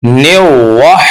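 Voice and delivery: वाह with lips rounded for र; wrong raa as waa. Shown as a demonstration. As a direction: show र turned into वाह. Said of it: "ने वा नहीं है". (गलत: ने वाह)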